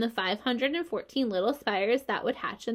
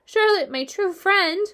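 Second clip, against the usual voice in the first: slow, high, loud wail of grief; anguished moan; 9 semitones higher, 7 dB louder than usual. A narrator's reading. Wilbur grieving for his dying friend, anguished moan. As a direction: moan it out, drawn out and sorrowful. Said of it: "he moaned".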